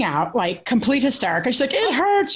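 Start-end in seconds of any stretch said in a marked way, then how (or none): 1.7-2.3 put-on high-pitched whimpering little girl; mock tearful child voice; imitating a crying child. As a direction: squeak it out like a hurt toddler. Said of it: "She starts crying".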